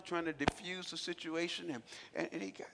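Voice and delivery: ordinary talk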